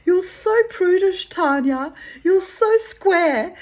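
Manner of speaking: high, loud mocking sing-song; teasing friend. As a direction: rib her with glee. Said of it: "She'd go".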